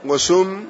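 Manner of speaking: وسم with the seen rounded to a short "u"; damma on وسم. Shown as a demonstration. Teacher giving the second vowelling, وسم said "wa-summ". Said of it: "فبفتح السين وضمها وكسرها".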